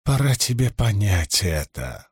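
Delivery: low voice rattling with pain. Acted. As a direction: force the words through a splitting headache. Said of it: "его голос дребезжал".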